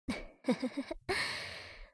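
soft, high little giggle; sly giggle. Acted. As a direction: giggle quietly to yourself. Brother amused beside sleeping sister. (こっそりクスクス笑い)

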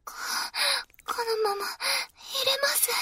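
soft, slow and shaky; trembling voice. Acted. Kouhai to senpai, softly and slowly trembling. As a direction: let the words tremble out slowly.

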